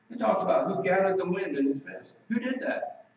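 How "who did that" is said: ordinary talk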